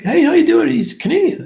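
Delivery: very high, loud, eager hello; imitated friendly greeting. Canadian legionnaire greeting a fellow countryman, excited. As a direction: imitate an enthusiastic stranger greeting you.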